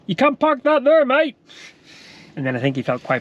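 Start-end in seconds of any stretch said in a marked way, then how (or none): 0.1-1.4 loud, high-pitched mock Cornish accent; put-on Cornish voice, pitched right up. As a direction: call it out like a Cornish local. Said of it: "with a Cornish accent".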